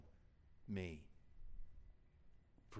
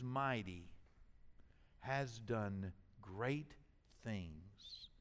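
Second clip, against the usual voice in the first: tender close; 4 semitones lower, 7 dB quieter, slower than usual. Acted. Sermon softening to tender close.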